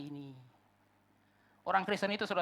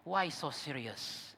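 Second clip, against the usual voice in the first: Joker; 3 semitones lower, 7 dB quieter, much slower than usual. Retold or imitated. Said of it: "Itu kata Joker".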